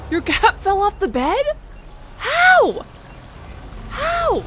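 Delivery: high, loud incredulous cry; shocked disbelief. Real, not acted. Streamer reacting to a chatter's cat falling off the bed, high shocked squeal. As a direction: squeal it in amazement. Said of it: "She rolled over and fell off".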